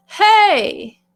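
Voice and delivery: loud forward chest-voice hey; chest voice call. Shown as a demonstration. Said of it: "to really tap into that chest voice".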